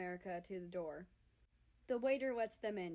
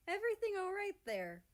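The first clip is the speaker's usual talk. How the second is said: gentle question; 12 semitones higher, 5 dB louder than usual. Acted. Russia asks gently.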